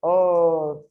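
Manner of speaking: loud long opening O, then each syllable apart. Shown as a demonstration. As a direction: sound it out loudly and slowly. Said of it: "separa em sílabas". (sílaba por sílaba, começando forte)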